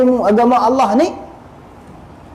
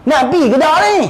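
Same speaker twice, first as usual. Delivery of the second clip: higher-pitched, exasperated nagging; impatient scolding; 8 semitones higher, faster than usual. Acted. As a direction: hurry him up, fed up. Scolding a dawdling companion. (menegur dengan tidak sabar, nada tinggi)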